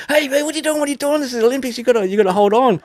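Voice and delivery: a scandalised Aussie calling out; indignant Aussie. Acted. Joke teller voicing the Aussie catching the monkey, high and indignant.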